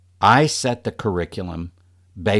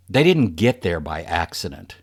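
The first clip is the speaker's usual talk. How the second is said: ordinary talk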